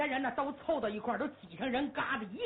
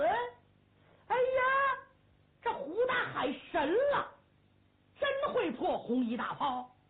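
amazed exclaim; 10 semitones higher, 3 dB louder, slower than usual. Acted. Onlookers amazed at survival.

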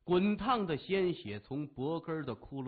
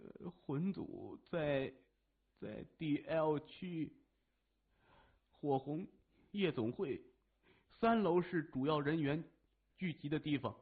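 hesitant confession; 3 dB quieter than usual. A narrator's reading. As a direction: give it up reluctantly, word by word. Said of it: "于是他低着头说".

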